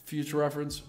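normal talking voice

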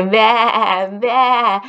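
lightly bleated baa; sheep baa. Shown as a demonstration. Teacher shows kids how sheep sound. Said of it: "They do say".

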